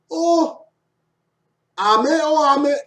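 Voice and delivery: high, hammed-up exclamation; mock amazement. Teacher making an ame pun, high mock amazement. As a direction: pitch it way up for the joke.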